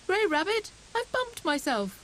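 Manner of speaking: high, whiny calling out; hurt little hare. A narrator's reading. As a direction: complain of a bump. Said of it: "he called".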